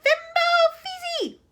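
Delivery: very high, loud, slow unearthly scream; frenzied scream. A narrator's reading. Wonka screaming nonsense at the aliens. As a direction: shriek it wildly. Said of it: "frenzied, unearthly sort of scream".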